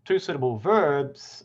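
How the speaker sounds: normal talking voice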